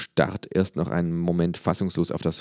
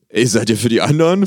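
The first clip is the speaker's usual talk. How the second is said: loud, puzzled football fan; confused fan; 5 semitones higher, 8 dB louder than usual. A narrator's reading. Fan asking the kangaroo, loud and confused. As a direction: blurt it out, baffled. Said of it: "fragt unser Patient nun verwirrt".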